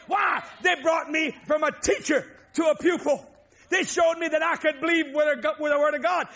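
preaching. Acted. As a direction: preach it out to the congregation. Preaching at full force.

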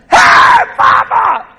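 very high, loud greeting; excited shout. Retold or imitated. Grandson greeting his late grandfather in a vision, excited shout. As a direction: holler with joy at seeing a loved one. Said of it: "I was so excited".

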